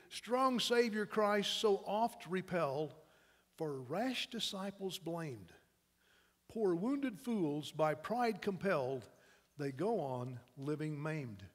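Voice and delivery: very slow, solemn verse, gradually softening; poem recital. Acted. Preacher reading the poem's sad conclusion, solemn recital. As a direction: draw out each line, sinking toward the end.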